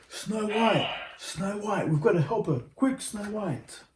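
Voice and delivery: hurry, call out in alarm; urgent dwarfs. Acted.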